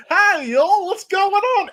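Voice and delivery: Southern yokel greeting. Acted.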